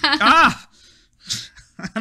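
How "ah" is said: very high, loud startled yelp. Acted. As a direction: yelp in fright. Alan startled by bird-dog.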